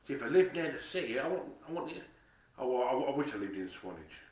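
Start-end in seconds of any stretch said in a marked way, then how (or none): none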